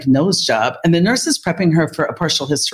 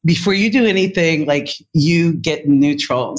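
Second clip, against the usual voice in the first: normal talking voice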